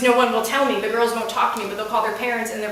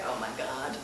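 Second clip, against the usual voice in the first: horrified whisper; 10 semitones lower, 13 dB quieter than usual. Retold or imitated. Horrified aside.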